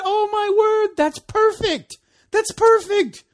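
high, loud, thrilled exclamation; excited delight. Retold or imitated. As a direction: gush with delight at a pupil. Thrilled at buddy's quick grasp.

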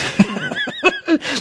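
high, soft joyful giggle; high giddy laugh. Real, not acted. Pastor laughing with joy during the sermon. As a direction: giggle with delight.

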